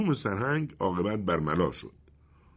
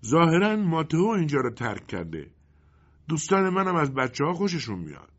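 higher-pitched character reply; lightly voiced forest genie; 8 semitones higher than usual. Genie explaining to the colonel why they left, lightly voiced. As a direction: answer in a raised, lightly acted voice.

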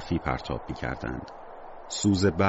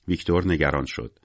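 usual speaking voice

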